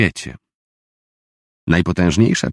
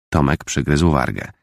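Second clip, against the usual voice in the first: ordinary delivery, nothing marked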